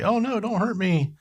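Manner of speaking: high-pitched mock little-girl plea; frightened little girl. Cohost imitating the fleeing suspect's screaming, in a high little-girl voice. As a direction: squeal it like a scared little girl, put on. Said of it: "almost like a little girl running away".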